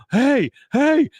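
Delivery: high loud hey hey; Hank calling out. Retold or imitated. Hank bangs on the glass to get the band's attention, calling high and loud. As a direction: call out sharply to get attention. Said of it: "then he says".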